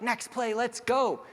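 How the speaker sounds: high, upbeat cheer to move on; a team cheering on someone who just struggled; rallying call. Retold or imitated. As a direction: call it out to pick a teammate up.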